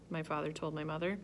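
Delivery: usual speaking voice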